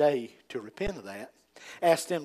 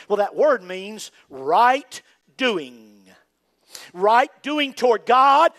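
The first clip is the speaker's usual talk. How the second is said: emphatic preaching; 4 semitones higher, 7 dB louder, much slower than usual. Acted. Emphatic slow definition.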